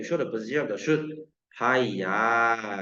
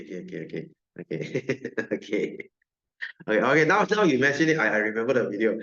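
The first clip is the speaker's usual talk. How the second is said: ordinary talk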